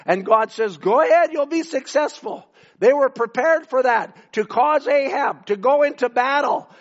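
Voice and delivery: fervent preacher at full force; preaching. Acted. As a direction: preach it out to the congregation.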